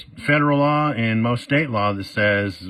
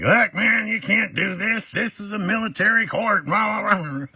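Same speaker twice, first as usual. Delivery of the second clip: going off; 14 semitones higher than usual. Retold or imitated. Friend rants at judge about military court, hammed-up high rant. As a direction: imitate a friend ranting at the judge. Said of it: "he just went off".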